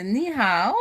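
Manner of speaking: "Ni hao" with Chinese tones, loud and rising; attempted Mandarin "Ni hao"; Chinese greeting. Shown as a demonstration. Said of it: "I will say".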